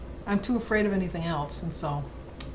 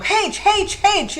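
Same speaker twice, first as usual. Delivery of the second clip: mock furious yell of haitch, much higher and louder; hammed-up angry Irish haitch; exasperated shouting; 13 semitones higher, 8 dB louder, much slower than usual. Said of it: "he'd get so mad".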